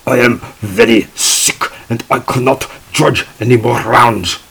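heavy mock German accent, pleading sick; imitating a German judge claiming illness. Parent judge begs the tab room to skip rounds, in mock German accent. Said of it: "in a very strong German accent".

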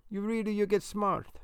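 put-on high-pitched Italian-accented grandmother; Italian grandma, voice pitched well up; thick Italian accent. A narrator's reading. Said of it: "in her thick accent".

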